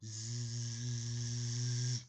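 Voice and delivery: a sleepy drawn-out snore; snoring. A narrator's reading. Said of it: "Amy was asleep".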